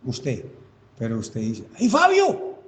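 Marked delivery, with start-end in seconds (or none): 1.8-2.6 high, loud cry for a friend; desperate call. Acted. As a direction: call out for help, pitched way up. Llamando a amigos desde el túnel. (llamado desesperado muy agudo)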